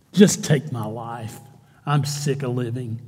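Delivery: low, slow, drained plea; slow, sunken, hopeless murmur; weary despair. Retold or imitated. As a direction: sigh it out like a man who's given up. Jonah's despairing plea voiced.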